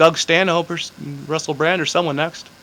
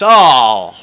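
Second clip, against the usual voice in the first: loud, falling "oh" of mock hurt; imitated pained groan; 10 dB louder than usual. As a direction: act out someone taking painful news. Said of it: "he was severely pained".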